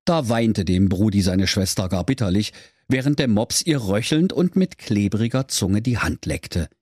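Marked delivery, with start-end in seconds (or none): none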